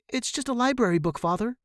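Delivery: put-on high, quick defensive kid voice; young son's anxious excuse; pleading boy. A narrator's reading. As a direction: explain it away like a scared son. Boy defends himself, high pitch.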